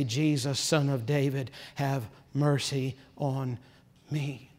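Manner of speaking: soft, slow, hushed plea; a hushed, drawn-out prayer for mercy; quiet plea. Retold or imitated. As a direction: murmur the beggar's cry under the breath. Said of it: "he began to cry out and say".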